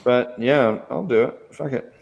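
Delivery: usual speaking voice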